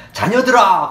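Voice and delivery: solemn loud scripture read. Acted. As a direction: proclaim the verses to the congregation. Reads scripture aloud.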